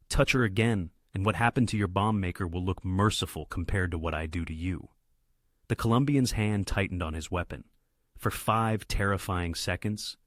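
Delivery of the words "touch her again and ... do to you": plain reading voice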